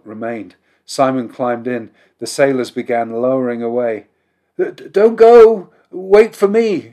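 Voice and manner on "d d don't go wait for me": high, loud stammering plea; desperate shout. A narrator's reading. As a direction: shout in panic, stumbling on the words. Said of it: "shouted a man in a fur coat".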